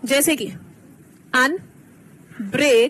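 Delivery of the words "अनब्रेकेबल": "अनब्रेकेबल" very slowly, with gaps between अन, break and एबल; split into morphemes. Shown as a demonstration. Teacher giving the class an example to divide, split into morphemes. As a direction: chop "अनब्रेकेबल" into its meaningful pieces. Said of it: "जब आप टुकड़े कर रहे हैं".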